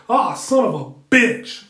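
loud, slow wrestling-announcer exclamation; wrestling commentator bellow; Jim Ross call. Retold or imitated. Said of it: "I'm sounding like Jim Ross on wrestling".

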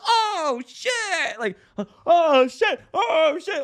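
very high, loud hammed-up shock; mock shock. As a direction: exclaim in comic disbelief. Retold shock in dream.

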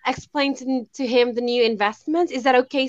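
stumbling put-on American accent; try-hard American accent. Shown as a demonstration. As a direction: fake an American telemarketer, trying hard. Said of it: "try hard ko, American accent".

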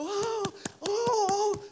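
high, loud wailing "oh"; mock crying. Pastor mimics church women crying over a departing friend. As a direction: wail like a tearful friend. People reacting to a mission announcement.

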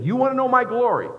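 high, loud proclaiming God voice. Acted. God asks the people, proclaiming loudly. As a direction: ring it out as God offering glory. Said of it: "It's him saying".

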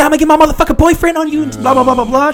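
high, fast, loud mock rant. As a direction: imitate an irate passenger rattling off threats.

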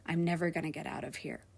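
quick, hushed and hopeless; crying in the basement, she thinks it, hushed and despairing; despairing thought. Retold or imitated. Hushed despair. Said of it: "the thoughts that ran in my head".

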